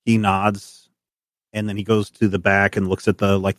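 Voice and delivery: ordinary talk, nothing marked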